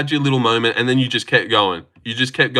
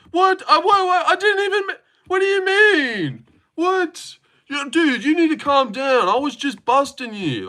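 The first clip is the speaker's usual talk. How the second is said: much higher, squeaky fake innocence; mocking whiny chatter; 14 semitones higher than usual. Streamer mimics the chatter playing innocent, squeaky high. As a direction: act baffled like a caught troll.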